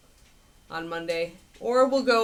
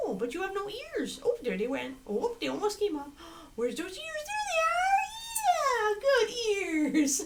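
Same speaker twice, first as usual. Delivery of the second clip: squeaky dog talk; 12 semitones higher than usual. Real, not acted.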